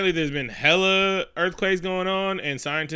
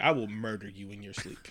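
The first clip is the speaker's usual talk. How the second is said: low, soft deadpan threat; quiet threat; 6 semitones lower, 9 dB quieter than usual. Retold or imitated. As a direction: imitate a kid's quietly menacing threat. Kid voiced threatening his stepdad.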